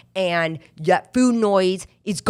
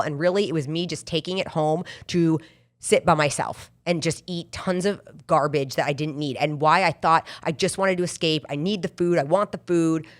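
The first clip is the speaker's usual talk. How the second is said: ordinary talk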